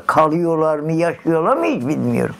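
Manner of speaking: normal talking voice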